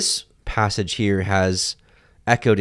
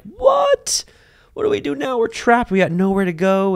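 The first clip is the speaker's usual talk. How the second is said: panicked; 13 semitones higher than usual. Retold or imitated. Trapped Israelites seeing the chariots, mock panic. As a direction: shriek it like you're trapped.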